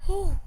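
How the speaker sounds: loud whew into a breathy laugh; relieved whew and laugh. Retold or imitated. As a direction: let the relief out. Relief after escaping flood.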